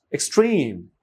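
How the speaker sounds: "Extreme" as ex-TREME, long falling second part; second-syllable stress. Shown as a demonstration. Teacher models an exception to memorise, second-syllable stress. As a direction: stretch and stress "trim" in "extreme". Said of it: "স্ট্রেসটা হচ্ছে পরের অংশে".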